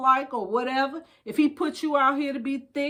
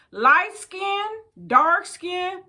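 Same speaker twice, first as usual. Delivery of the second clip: reading aloud; 8 semitones higher, 4 dB louder, much slower than usual. Acted. Reading the book's affirmations aloud.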